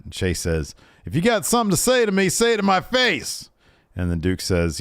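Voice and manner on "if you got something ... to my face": high-pitched, heated challenge in imitation; mock confrontation. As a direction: act out an indignant man squaring up. Acting Chase confronting Duke.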